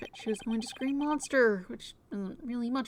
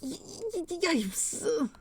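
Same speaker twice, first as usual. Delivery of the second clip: stammered yipes; 6 semitones higher than usual. Acted. Caught out, stammering.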